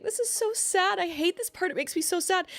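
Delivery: high, whiny imitation of herself; retelling her sad comment to Ander in a high mock whine. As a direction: play up your own sad whimper. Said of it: "'cause I was like".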